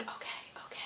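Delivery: embarrassed whisper. Retold or imitated. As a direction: breathe it out, barely audible. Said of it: "the woman in the front seat was like".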